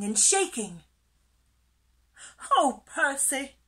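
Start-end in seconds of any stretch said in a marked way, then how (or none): 2.5-3.6 high, loud, amused tease; giggly teasing. A narrator's reading. Pufferfish teasing a frightened friend, amused. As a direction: tease with a falling lilt. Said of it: "giggled Pufferfish".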